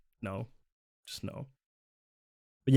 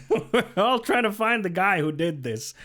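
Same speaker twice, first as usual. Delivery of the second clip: laughing; 7 semitones higher than usual. Shown as a demonstration. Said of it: "it's basically this".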